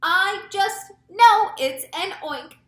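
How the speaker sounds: high, loud, slow insistence; drawn-out storybook scream. A narrator's reading. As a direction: cry it out, sure of the danger. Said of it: "screamed the head of the fire department".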